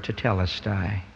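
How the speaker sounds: hushed word. Shown as a demonstration. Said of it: "I hope that we'll hear that word".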